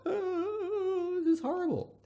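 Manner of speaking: put-on high, scared child voice; a boy watching hell on screen, horrified; frightened little boy. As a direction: recoil like a horrified kid. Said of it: "little Johnny's going".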